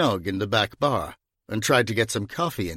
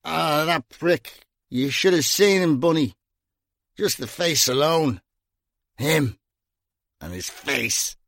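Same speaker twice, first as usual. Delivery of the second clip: put-on slow, sloppy, petty drunk rant; slurred drunk; 4 semitones higher than usual. A narrator's reading. Drunk Gringo sneering about the student, slurred. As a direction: let the words blur and trail off. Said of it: "Gringo's voice was slurred".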